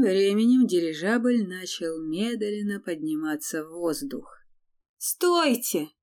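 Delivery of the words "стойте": high, loud call to stop; shout. A narrator's reading. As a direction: yell after the airship. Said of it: "громко закричала Лея".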